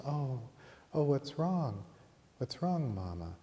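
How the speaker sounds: put-on high, worried child's voice; tender concern. Little girl to her crying mother in the dream, tender concern.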